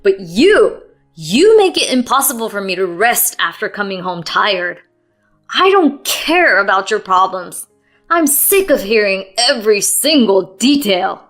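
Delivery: loud, furious tirade; relentless anger. Acted. Husband venting at wife, furious. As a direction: vent it all at her. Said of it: "he was relentless".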